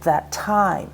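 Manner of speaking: "That time" with a light "That" and a drawn-out "time"; stress on TIME. Shown as a demonstration. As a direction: lean on "time" in "That time". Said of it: "notice how time is stressed".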